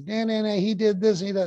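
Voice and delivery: put-on high, whiny child voice tattling on a brother; tattling kid. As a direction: play a little kid blaming the other. Kid whining at sibling.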